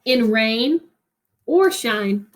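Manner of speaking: put-on reading a picture book to children, rhythmic and lilting; expressive read-aloud narration; story reading. Teacher reads picture book aloud.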